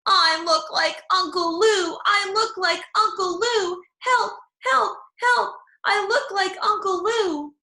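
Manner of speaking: high, loud panicked yelling; shouting. A narrator's reading. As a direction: shout it like a frantic little girl. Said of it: "I kept running and shouting".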